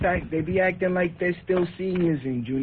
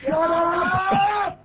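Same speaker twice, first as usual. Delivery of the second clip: shouting; 9 semitones higher, 4 dB louder than usual. Real, not acted. Shouting match between callers.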